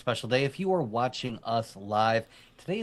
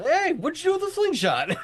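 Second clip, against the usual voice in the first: much higher, louder mock scolding father; mock dad; 5 dB louder than usual. Co-host imitates the father scolding his son, hammed-up. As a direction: ham up the annoyed dad.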